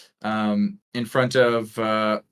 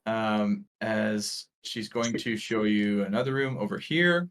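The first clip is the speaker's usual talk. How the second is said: usual speaking voice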